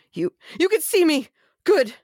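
thrilled someone finally sees you; relieved excitement. Acted. Xander relieved to be seen.

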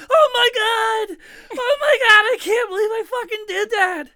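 shrieking, half-laughing panic way up high; screaming and sobbing in shock; crying freak-out. Retold or imitated. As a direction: relive a kid's panicked shriek after hitting the bird. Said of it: "I started fucking crying and freaking out".

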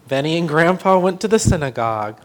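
plain reading voice

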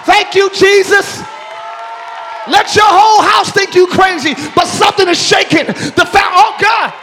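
loud, high preaching at full force. Real, not acted. Preacher rousing his congregation to praise, loud and high. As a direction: shout it to the back pews as the climax builds.

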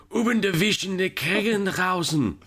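playing a German saying flower pot as a long compound; over-the-top mock German gibberish. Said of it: "You wanna say flower pot".

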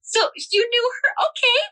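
mock-welcoming aunt, pitched high; gushing aunt. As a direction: squeal with nosy delight. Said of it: "she would be the first one to be like".

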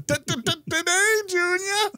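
high-pitched stuttering movie quote; stuttering impression. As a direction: stammer it out in a squeaky voice. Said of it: "Billy Madison".

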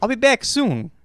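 sing-song it like a goofy recording; cheesy voicemail greeting. Pretend. Joining the voicemail bit.

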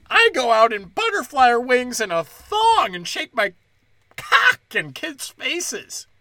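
high sarcastic whine; hammed-up indignant scold; mocking voice. Mocking the other side.

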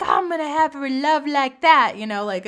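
high, loud mock teenage gushing; dreamy teenager. Teenager dreaming of future love, gushing in a high put-on voice. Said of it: "you know, as teenagers. We're like".

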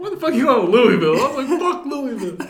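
fast, high, loud half-joking outburst; hammed-up indignant rant; mock outrage. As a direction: scold the Louisville trip, laughing. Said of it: "But I was like".

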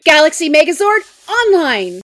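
high, loud hero call; cockpit shout. Acted. Pink Ranger in her cockpit, a high loud call. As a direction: announce the Megazord with full force.

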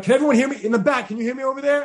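presenter checks the back row can hear, projecting loudly. Retold or imitated. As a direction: call out to the back of the room without a mic. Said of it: "I'll just project my voice".

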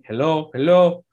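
hammed-up Italian immigrant English; put-on Italian accent in broken English. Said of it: "He is speaking in this Italian accent".

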